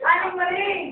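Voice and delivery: high camp cry of alarm; effeminate. Acted. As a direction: call out in a mock effeminate voice. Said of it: "Ba't naman naging bading kayo?". (pabading na tili, mataas)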